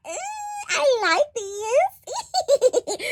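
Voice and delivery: high, slow, dreamy swoon with a laugh. Retold or imitated. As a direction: melt over the cute couple. Swooning over couple's banter.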